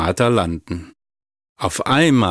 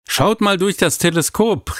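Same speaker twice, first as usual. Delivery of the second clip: put-on much higher, eager child's call; excited call; 10 semitones higher than usual. A narrator's reading. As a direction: wave the others over, thrilled. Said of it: "rief Maral aufgeregt".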